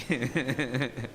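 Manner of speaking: quick amused chuckle; short laugh. Real, not acted. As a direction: laugh at your own joke. Laughs while teasing students.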